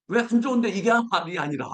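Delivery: ordinary talk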